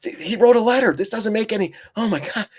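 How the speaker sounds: high-pitched mock panic; mocking a freaked-out retail trader, voice jumped up high; panicked trader. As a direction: squeak it out like a frantic day trader. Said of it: "you're like".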